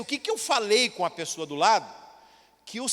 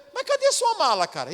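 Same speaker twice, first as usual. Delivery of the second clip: much higher, sudden question; surprised question; 9 semitones higher than usual. Retold or imitated. Pastor to the preacher in the car, high surprised question. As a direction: jolt with sudden realization. Pastor asks about the suitcase.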